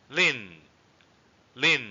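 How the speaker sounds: "Lin" high, then falling sharply. Shown as a demonstration. Teacher models the word for tongue, sharp falling tone. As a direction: drop "Lin" fast from a high pitch. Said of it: "a hard or sharp fall".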